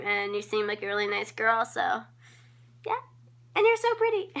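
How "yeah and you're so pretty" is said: squeaky gush. Acted. Gushing compliment to a follower.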